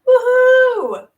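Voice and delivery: high, loud mock cheer; woohoo cheer. Celebrating a slow month of subscribers, mock cheer. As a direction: whoop it up over a hundred subs. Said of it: "I'm like".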